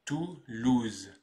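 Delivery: TOULOUSE split as Tou, louse; slow TOULOUSE, pause between the two syllables; syllable-by-syllable demo. Shown as a demonstration. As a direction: break TOULOUSE into two parts for learners. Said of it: "Et on prononce donc".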